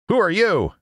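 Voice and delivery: high-pitched challenge; high, startled demand; crying out. Acted. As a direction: cry out sharply at a stranger. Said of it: "cried out".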